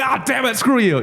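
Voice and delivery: high-pitched, half-joking curse at the troll; mock frustrated outburst. Fighter retelling her reply to a troll, mock frustrated. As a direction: throw it out like a sore loser, playfully.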